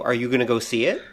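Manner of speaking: ordinary talk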